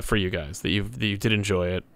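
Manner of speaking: ordinary delivery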